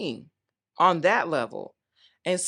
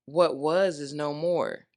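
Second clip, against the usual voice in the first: ordinary talk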